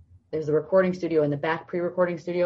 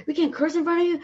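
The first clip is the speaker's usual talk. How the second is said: high, mocking echo of her rule; mocking coworker; 12 semitones higher, faster than usual. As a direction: parrot her rule back in a snide high voice. Coworkers mock her no-curse rule.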